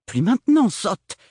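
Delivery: high, loud, snapping; irritated. Acted. Agathe annoyed at Ophélie, high and snappish. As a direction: scold the silly sister. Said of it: "s'agaça-t-elle".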